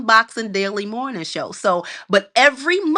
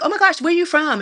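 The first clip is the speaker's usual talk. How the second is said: higher, eager question; mock surprised LA stranger; curious surprise; 8 semitones higher than usual. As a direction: ask like an intrigued stranger. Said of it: "people would always be like".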